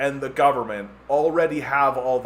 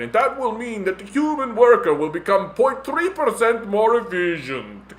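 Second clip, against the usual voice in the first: mock villain planner; 3 semitones higher, 6 dB louder than usual. Mock WEF official speech.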